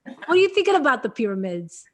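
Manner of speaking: loud New York caller; playful, loud New York impression; New York accent. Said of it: "do a good New York one".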